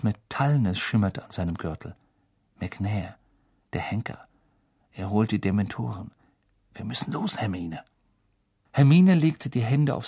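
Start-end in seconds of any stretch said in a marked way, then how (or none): 2.6-7.8 tense whisper. A narrator's reading. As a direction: whisper it, fearing discovery. Harry whispers in hiding.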